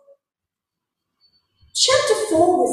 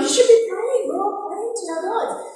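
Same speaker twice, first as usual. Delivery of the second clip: higher, urgent sailor voice, pleading; mock panicked sailors; frantic sailors; 6 semitones higher than usual. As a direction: shake the sleeping man awake in alarm. Panicked sailors waking Jonah.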